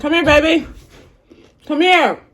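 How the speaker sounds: high loud call. Real, not acted. Calling a pet or child.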